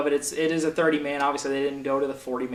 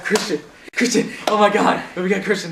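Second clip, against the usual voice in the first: excited; 5 semitones higher, 6 dB louder than usual. Real, not acted. Excited at thought of return.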